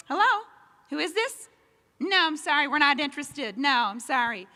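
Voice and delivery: high, tight fake-polite refusals; mom on phone. Mom fending off a telemarketer, high and tightly polite. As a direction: brush off the caller with clipped politeness. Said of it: "so angry at me".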